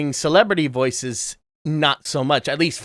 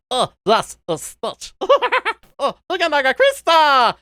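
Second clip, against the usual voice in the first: excited high-pitched creature gibberish; Stitch; 12 semitones higher, 4 dB louder than usual. Shown as a demonstration. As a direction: squeal it out as a gleeful little alien. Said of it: "So I always start with".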